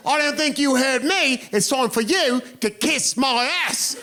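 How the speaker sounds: put-on slow, cocky British accent sneer. Drunk Brit throwing the American's words back, British accent. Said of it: "in that accent".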